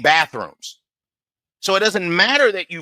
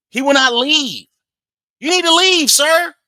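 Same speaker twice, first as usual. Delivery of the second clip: exasperated; 9 semitones higher, 5 dB louder than usual. Real, not acted. Exasperated reaction to man not leaving.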